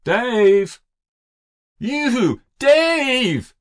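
high, drawn-out loud holler; calling out after him. Acted. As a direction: holler for the boy across the yard. Mother calls after running son.

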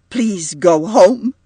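loud, slow, exasperated plea; sudden outburst. A narrator's reading. Upset mother orders the guest to leave, sudden outburst. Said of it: "she broke out suddenly".

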